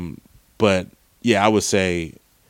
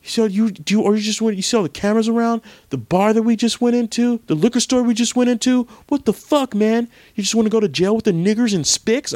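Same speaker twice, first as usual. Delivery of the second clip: high, over-the-top outraged thug; evil supremacist; 13 semitones higher, faster than usual. Retold or imitated. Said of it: "He was like".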